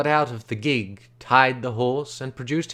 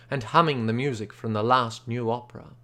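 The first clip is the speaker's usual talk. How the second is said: usual speaking voice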